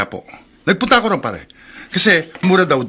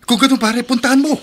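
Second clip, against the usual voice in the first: higher, pushing him to go; eager urging; 8 semitones higher than usual. Acted. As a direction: press your friend hard. Urging friend to go. (masigasig na pag-udyok sa kaibigan)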